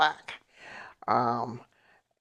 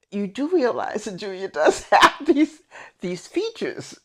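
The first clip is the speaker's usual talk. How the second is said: lightly voiced flustered teacher, higher, laughing through it; 7 semitones higher, 4 dB louder than usual. Teacher insisting to angry mother, lightly flustered. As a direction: play the teacher backing down awkwardly. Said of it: "the teacher turned so red".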